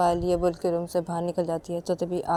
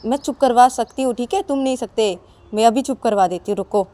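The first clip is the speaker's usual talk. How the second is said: confident wife; 4 semitones higher, 9 dB louder than usual. Acted. Sakshi confident.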